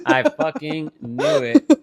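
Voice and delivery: mock-annoyed I knew it; exasperated outburst. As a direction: drag it out like you saw it coming. Retold outburst, louder and slow.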